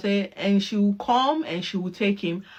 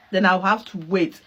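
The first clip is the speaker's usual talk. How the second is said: ordinary talk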